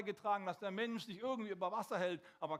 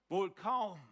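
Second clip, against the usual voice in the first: ordinary talk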